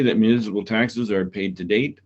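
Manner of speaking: ordinary delivery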